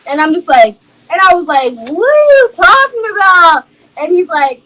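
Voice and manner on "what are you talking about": retold disbelief. Retelling her shocked confrontation.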